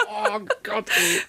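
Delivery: shrieking laugh fading to a breathy oh God; real laughter, high then soft; high laughing fit. Real, not acted. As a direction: crack up, then sigh it out. Listener cracks up laughing.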